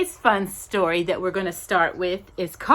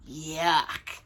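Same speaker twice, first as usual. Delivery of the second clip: mock disgust; 8 semitones lower, 7 dB quieter than usual.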